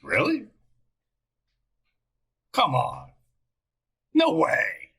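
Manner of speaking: high-pitched, giddy disbelief and delight; excited. Real, not acted. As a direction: get carried away, voice leaping up. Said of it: "I got all excited".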